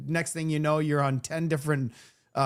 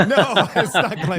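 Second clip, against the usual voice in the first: loud, higher protest through laughter; laughing protest; 5 semitones higher, 9 dB louder than usual. Real, not acted. Host correcting a teasing guest, laughing protest. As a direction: laugh while hurriedly denying it. Teased over a word slip.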